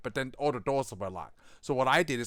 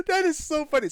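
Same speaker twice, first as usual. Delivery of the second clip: amused; 13 semitones higher, 6 dB louder than usual. Real, not acted. Still laughing.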